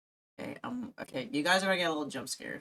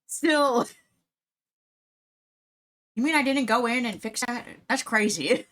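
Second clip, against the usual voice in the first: high, loud disbelief; surprised; 8 semitones higher, 5 dB louder than usual. Real, not acted. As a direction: act shocked at yourself. Mock disbelief at herself.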